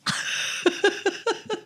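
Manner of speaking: airy squeaky giggle; high breathy laugh. Real, not acted. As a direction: wheeze with laughter. Laughing at gift of gab.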